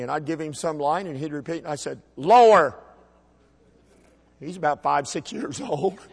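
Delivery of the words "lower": loud, punched one-word order; drill-like command. Retold or imitated. Dad correcting his little boy's voice, loud command. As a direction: belt it out as a correction.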